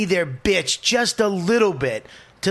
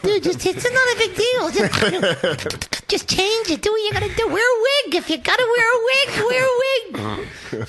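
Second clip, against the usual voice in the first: very high, squeaky mock weasel; hammed-up falsetto wheedling; weaselly pleading. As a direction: plead in a tiny falsetto, mocking yourself. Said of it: "Little weasel I am".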